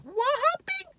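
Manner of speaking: extremely high, mock falsetto squeal. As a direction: shoot the question up into a squeak. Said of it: "It just goes the octave higher".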